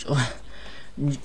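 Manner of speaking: ordinary talk, nothing marked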